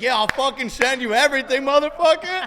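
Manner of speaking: high and loud, laughing through it; mock female voice. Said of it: "She's like".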